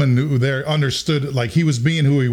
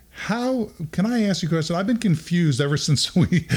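higher, tentative query; pitched-up, half-amused confusion; puzzled questioning; 6 semitones higher than usual. Retold or imitated. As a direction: imitate a baffled dad asking politely. Puzzled dad questions the producer.

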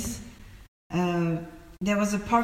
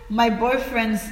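ordinary talk, nothing marked

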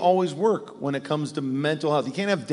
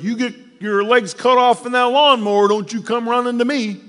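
much higher and louder mock scolding; gruff dad; 9 semitones higher, 9 dB louder than usual. Father warning his kid about the lawnmower, mock scolding. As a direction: bark it like an old-school dad. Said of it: "my dad would say things like".

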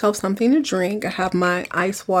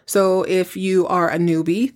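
ordinary delivery

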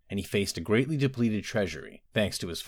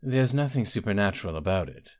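ordinary delivery

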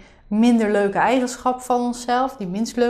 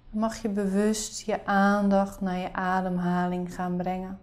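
soft spa whisper; 3 semitones lower, 9 dB quieter than usual. Acted. Leads a guided meditation.

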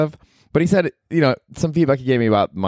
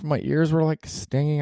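ordinary delivery

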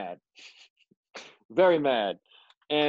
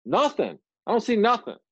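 mildly exasperated, higher-pitched denial; a touch of frustrated protest; aggravated; 8 semitones higher, 4 dB louder than usual. Retold or imitated. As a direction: snappish retold comeback to God. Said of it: "I'm just sitting there aggravated".

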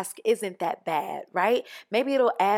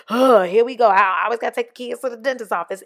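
louder, fast whiny grumble; mock complaining; 2 semitones higher, 8 dB louder, faster than usual. Mocking a mom who groans about dentist trips, whiny. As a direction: gripe like a tired mom with a full schedule.

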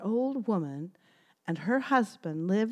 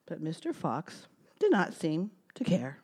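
ordinary delivery

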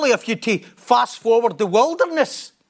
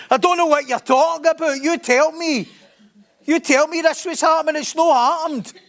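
high-pitched comic protest; indignant mock complaint; 6 semitones higher than usual. Let-down believer disputes the promise made in March, indignant.

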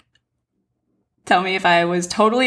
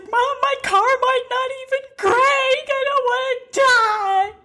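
much higher, slow, tearful wail; crying plea to mom; slower than usual. Retold or imitated. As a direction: sob it out in pure panic. Panicking, fearing for her life.

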